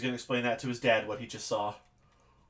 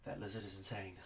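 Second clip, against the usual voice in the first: much softer, lower private mutter; muttering to himself; 4 semitones lower, 13 dB quieter than usual. Acted. Manny, hiding his face, mutters to himself. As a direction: say it under your breath, turned away. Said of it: "a little conversation with himself".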